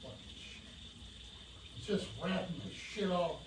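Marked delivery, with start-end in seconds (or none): 0.0-0.6 whispered curse. Real, not acted. Frustrated muttering while working.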